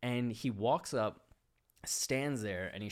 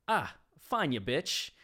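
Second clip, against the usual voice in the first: higher, louder bratty retort; sulky kid; 5 semitones higher, 5 dB louder than usual. Retold or imitated. Rejected boy snapping back at the woman, loud and bratty. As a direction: toss the insult like a sore loser.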